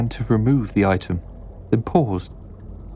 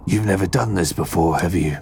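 rough voice dropping low; one-eyed man; 3 semitones lower than usual. A narrator's reading. One-eyed man questions Ben's experience, gruff. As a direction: size up the novice in character. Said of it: "A huff of laughter".

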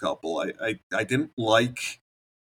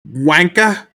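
loud, higher British jeer "wanker"; British insult; 8 semitones higher, 11 dB louder than usual. Pretend. Joining the British parody, loud cockney jeer. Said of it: "they hated British people in that show".

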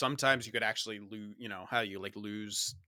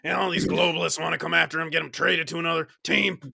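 mock angry rant; 4 semitones higher, 9 dB louder than usual. Mock rant about globalist media.

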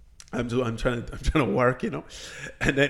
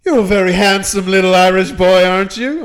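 a hint of the leering mayor; mock smarmy compliment; flirty mayor; 3 semitones higher, 7 dB louder than usual.

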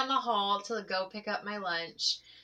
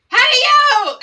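high, very loud shout; full-volume mimicked call; imitated kid's yell; 17 dB louder than usual. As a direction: holler like an unfiltered schoolkid. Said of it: "yell at the top of his lungs".

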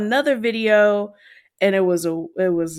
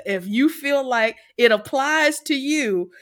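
ordinary talk, nothing marked

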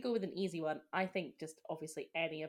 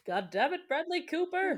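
mock curse; 11 semitones higher, 9 dB louder than usual. Real, not acted. Mock cursing actor after tearing up.

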